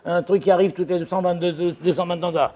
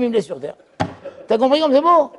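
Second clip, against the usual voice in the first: ordinary talk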